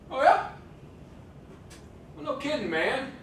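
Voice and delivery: a cheeky villager scoffing at the obvious; sarcastic villager. Retold or imitated. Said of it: "look him right in the face and go".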